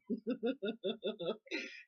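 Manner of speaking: real gleeful giggle; helpless little run of giggles; giggling. Real, not acted. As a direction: chuckle with guilty delight. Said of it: "giggling like this".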